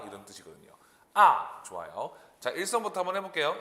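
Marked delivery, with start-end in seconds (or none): 1.1-1.6 "아" starting high, plunging sharply, loud; fourth tone. Shown as a demonstration. Teacher modelling the fourth tone, loud sharp drop. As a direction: fire "아" straight down from the top. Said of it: "이 사 성".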